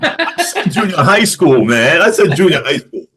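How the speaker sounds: real laughing, high-pitched protest. Real, not acted. Teased about junior high French, laughing protest.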